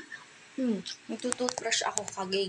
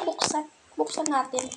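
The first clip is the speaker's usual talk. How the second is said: ordinary delivery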